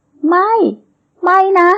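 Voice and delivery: high-pitched cry of horror, climbing; screamed denial. A narrator's reading. Heroine sees her murdered friend's face in a vision, screaming. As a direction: scream it in refusal, pitch rising sharply. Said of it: "เธอกรีดร้อง".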